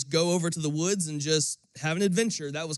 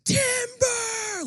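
very high, loud shouted call; kids' loud warning cry, much higher; yelling timber; 4 dB louder than usual. Retold or imitated. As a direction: bellow it across the woods as the tree falls. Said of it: "she hears us go".

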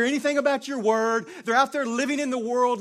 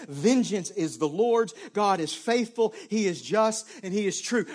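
ordinary talk, nothing marked

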